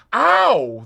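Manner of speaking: sharp high ow; pinched yelp. Acted. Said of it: "Mister Beresford obliged".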